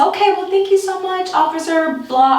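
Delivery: high, overly polite thanks; high-pitched forced politeness; polite farewell. Retold or imitated. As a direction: sound cheerful to get away. Polite goodbye to the cop.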